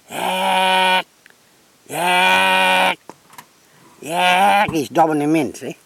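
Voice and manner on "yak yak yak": long, raised single squawks. Retold or imitated. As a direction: hold each cockatoo squawk, higher than speech. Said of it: "He go".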